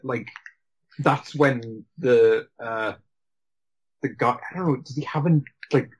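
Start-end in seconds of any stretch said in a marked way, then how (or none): none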